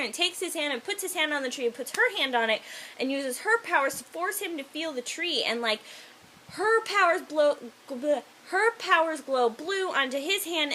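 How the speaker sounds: ordinary talk, nothing marked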